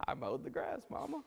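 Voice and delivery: put-on child's reply. Child answering the angry mother.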